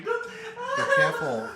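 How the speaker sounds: high, slow laughing warning. Real, not acted.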